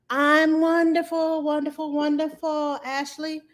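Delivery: sing-song. Real, not acted. Answering viewer greeting sing-song.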